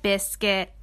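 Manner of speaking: plain reading voice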